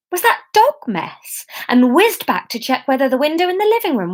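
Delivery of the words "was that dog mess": high, slow disgusted question; disgusted shock. Acted. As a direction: recoil at the smell. Disgusted realisation.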